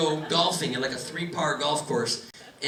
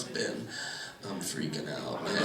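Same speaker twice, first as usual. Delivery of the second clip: lightly voiced panicky father, low and soft; a hint of a freaked-out stoned dad; panicked dad; 13 semitones lower, 11 dB quieter than usual. Comic quoting his father's panic on the roadside. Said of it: "here were his exact words".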